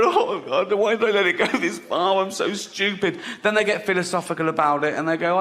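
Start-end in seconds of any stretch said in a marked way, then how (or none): 0.0-3.2 higher-pitched tearful wail, imitated; mock crying. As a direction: imitate a regretful patient in tears. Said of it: "they're crying and I'm crying".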